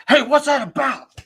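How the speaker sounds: loud, high-pitched angry challenge; aggressive confrontation. Retold or imitated. Young men confronting a sign holder, aggressive. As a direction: imitate an aggressive guy getting in your face. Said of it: "super aggressive guys".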